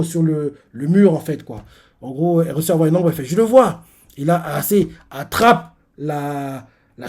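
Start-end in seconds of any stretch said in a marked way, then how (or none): 3.3-3.8 découverte excitée. Retold or imitated. Ayase repère l'ombre.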